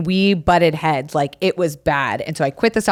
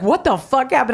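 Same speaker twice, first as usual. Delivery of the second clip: confused sister; 6 semitones higher than usual. Retold or imitated. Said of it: "And she was like".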